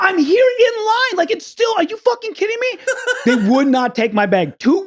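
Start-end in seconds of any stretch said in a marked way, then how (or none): 0.0-2.8 very high imitated pleading outrage; frantic protest. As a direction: plead in disbelief, hammed up. Retold frantic argument with clerk.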